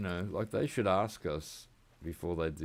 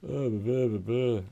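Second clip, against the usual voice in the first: mocking blah blah; 5 semitones higher, 7 dB louder than usual. Mocking politicians' speeches.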